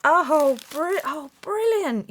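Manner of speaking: high, loud burst of delight; thrilled. Real, not acted. Crafter spotting hand-drawn houses, high thrilled exclaim. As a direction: break off and squeal brilliant.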